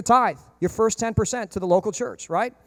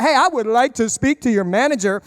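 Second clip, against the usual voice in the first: hammed-up demand for the manager; mock entitled customer; 4 semitones higher, 4 dB louder than usual. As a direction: play the upset customer.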